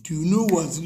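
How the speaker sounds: ordinary talk, nothing marked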